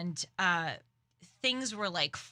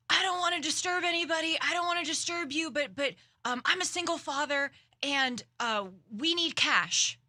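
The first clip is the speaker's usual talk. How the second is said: higher, louder, fast pleading for money; pleading stranger; 8 semitones higher, 4 dB louder than usual. Retold or imitated. A man on the subway begs riders for cash, high fast pleading. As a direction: rush the plea like a desperate father asking passengers for cash. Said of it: "And this guy starts saying".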